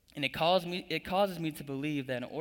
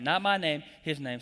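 Not my name louder, his name dropped back; call for the congregation; 4 dB louder than usual. Shown as a demonstration. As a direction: punch the word my, then settle on his. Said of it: "Say that with me".

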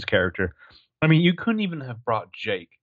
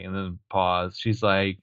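usual speaking voice

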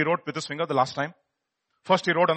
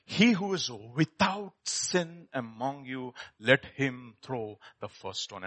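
soft, slow, solemn words; calm solemn; 4 semitones lower, 7 dB quieter, slower than usual. Acted. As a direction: speak it quietly and gravely. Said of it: "he raised himself and said to them".

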